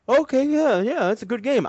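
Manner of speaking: bright salesman approval; chirpy clerk. Retold or imitated. Clerk approving his purchase, in a high chirpy voice.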